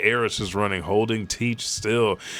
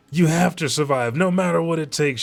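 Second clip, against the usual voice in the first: higher and louder desperate yell, a bit faster; 8 semitones higher, 4 dB louder than usual. Retold or imitated. Eris yelling to Teach to survive, higher, louder and desperate. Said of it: "she yells".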